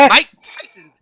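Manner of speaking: high, loud lisping boxer voice; Mike Tyson. Acted. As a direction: blurt it high in the boxer's lisp. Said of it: "an imitation of a guy".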